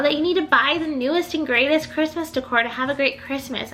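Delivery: normal talking voice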